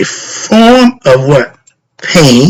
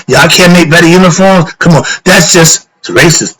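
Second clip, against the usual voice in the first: usual speaking voice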